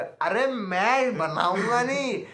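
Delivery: high, loud and slow, gleefully announcing it; retold excitement, stretched out and pitched high; excited boast. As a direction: savour every word like a proud treat. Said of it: "मैंने बोला".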